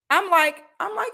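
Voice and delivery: ordinary delivery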